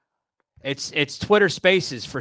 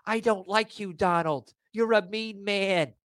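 mocking Joy Behar; 4 semitones higher than usual. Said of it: "He's not like Joy Behar".

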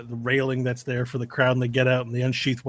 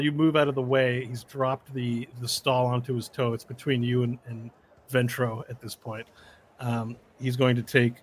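ordinary delivery

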